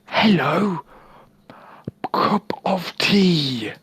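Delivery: high, loud, over-the-top posh English; hammed-up English accent, loud and high; mock English accent. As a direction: ham up a stuffy Brit ordering tea. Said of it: "Let me try and do a bit of English".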